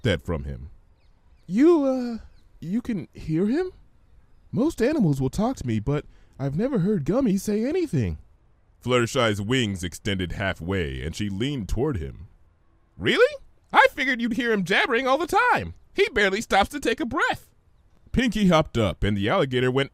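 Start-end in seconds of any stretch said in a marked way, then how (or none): 1.5-8.2 timid pony voice. Acted. Narrator voices timid Fluttershy. 13.0-17.5 very high, rapid, scatterbrained chatter; bubbly high pony voice. Acted. Narrator voices bubbly Pinkie.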